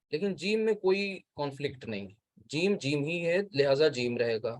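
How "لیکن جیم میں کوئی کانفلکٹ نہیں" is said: ordinary talk, nothing marked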